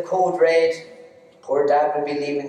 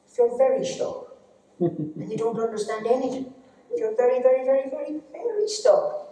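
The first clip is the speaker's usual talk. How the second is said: climbing higher and higher on each very; rising pleading whine; 8 semitones higher than usual. Acted. Mocking the stuck pupil, climbing higher in pitch. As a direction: wind up higher with every very. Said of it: "mocking slow pupil".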